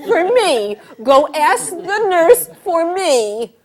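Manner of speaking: loud, high, dragged-out order; demanding laboring wife. Acted. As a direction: insist slowly at full voice. Laboring woman demands loudly.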